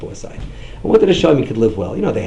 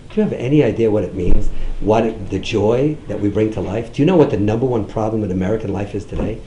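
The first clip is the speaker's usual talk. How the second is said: ordinary talk, nothing marked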